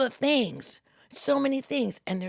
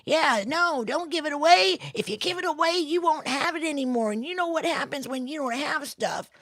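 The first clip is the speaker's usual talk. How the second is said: nagging inner voice; 7 semitones higher than usual. Acted. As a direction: squawk warnings like a fretful little self. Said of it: "this little you that comes in and says".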